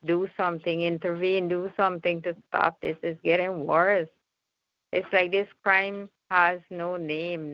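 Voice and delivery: normal talking voice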